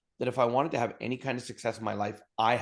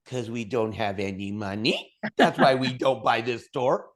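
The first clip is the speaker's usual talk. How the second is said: slow, exasperated, getting louder at the end; incredulous; 4 dB louder than usual. Retold or imitated. Husband telling his wife why they can't buy, slowly and in disbelief. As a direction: spell out the obvious to his wife. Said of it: "I looked at her like she was off her rocker".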